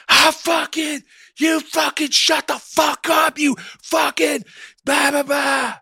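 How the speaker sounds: high, loud mock screech at her kid; imitating a screaming mom. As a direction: scream it like a furious mother losing it. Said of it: "screaming as loud as she could".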